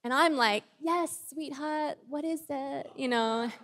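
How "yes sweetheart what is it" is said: soft, slow, higher sing-out reply; sweet, patient mum. Retold or imitated. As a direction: answer your child with drawn-out sweetness. Patient mum first answers child.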